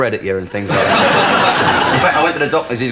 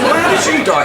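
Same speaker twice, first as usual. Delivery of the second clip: squeaky, hammed-up alarm, much higher; high mock panic; 3 dB louder than usual. Comedian voicing the farm owner's escalating panic, hammed-up high. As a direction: play the owner nearly shrieking in mock horror.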